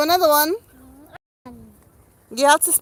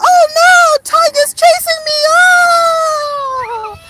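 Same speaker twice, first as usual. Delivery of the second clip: high, loud mock cries for help; playful fake terror; pretend panic; 14 semitones higher, 6 dB louder, much slower than usual. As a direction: ham up being chased. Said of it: "She's only joking".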